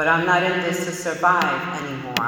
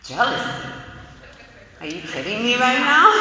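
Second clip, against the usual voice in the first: higher and louder, scoffing at the word; indignant disbelief; 7 semitones higher, 4 dB louder than usual. Retold or imitated. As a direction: bristle like you've been insulted. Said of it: "And I'm like".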